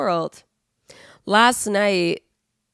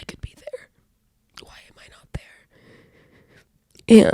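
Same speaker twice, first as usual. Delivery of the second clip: whispered inner thought; 2 semitones higher, slower than usual. Retold or imitated. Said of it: "because I was like".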